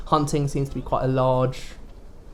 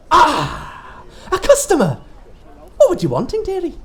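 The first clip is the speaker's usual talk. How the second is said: put-on high, sly old shopkeeper voice; sweet but sinister seller; sly mysterious shopkeeper; 13 semitones higher, 6 dB louder than usual.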